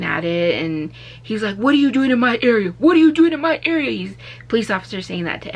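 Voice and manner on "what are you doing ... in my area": fast and loud, rising higher and louder on the repeat; aggressive demand. Retold or imitated. Officer to driver at van, escalating angry demand. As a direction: grill him like a hostile cop.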